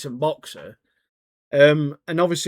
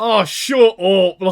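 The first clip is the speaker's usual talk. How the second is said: slow, louder fed-up snap; annoyed; 5 semitones higher, 8 dB louder, much slower than usual. Retold or imitated. Senior officer telling Wu Jin to shut up, slow and annoyed. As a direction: brush him off, drawn out and irritated. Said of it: "He's like".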